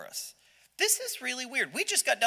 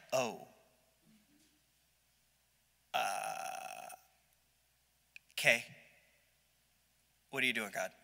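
low, soft, halting bewilderment; stunned and deflated; 9 semitones lower, 9 dB quieter than usual. Acted. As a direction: let the air go out of him. Gideon stunned by God's order.

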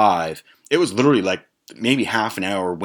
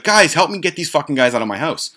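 higher, worked-up imitation; exasperated plea; 7 semitones higher, 3 dB louder than usual. Chris begging friends for help, exasperated. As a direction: plead like a mock-frantic host.

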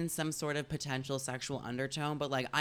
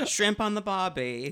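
higher, louder mock Australian accent; attempted Australian accent; 5 semitones higher, 6 dB louder than usual. Giving in after refusing, hammed-up Australian accent. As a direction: have a joking go at an Australian accent. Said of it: "giving you an Australian accent".

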